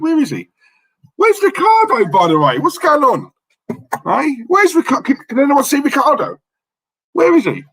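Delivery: playful high-pitched calling around the room; mock calling out.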